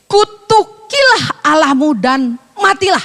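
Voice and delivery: slow, weighty reading; slow reading of the curse. Shown as a demonstration. Reading the wife's curse to Job from Scripture, slow and weighty. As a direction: draw out Kutukilah Allahmu dan matilah slowly. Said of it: "Maka berkatalah istrinya kepadanya".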